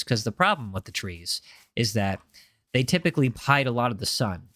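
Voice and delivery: deep soothing ASMR streamer voice. Shown as a demonstration. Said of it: "A S M R, let's go".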